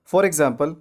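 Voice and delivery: normal talking voice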